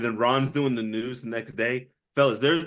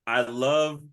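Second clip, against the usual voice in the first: ordinary talk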